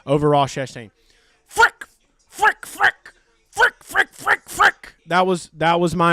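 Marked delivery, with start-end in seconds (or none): 1.5-5.0 very high, squeaky staccato bursts; driver's clean-cursing impersonation. Acted. Driver three points to the bad, frantic squeaky impersonation. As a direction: squawk each mock curse separately, much higher than usual. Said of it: "This is my impersonation of Joey Logano".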